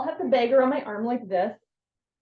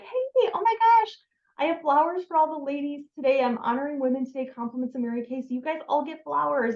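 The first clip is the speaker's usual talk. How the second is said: excited greeting; 5 semitones higher than usual. Shown as a demonstration. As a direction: gush to a group of ladies. Demonstrating pitch to groups of ladies.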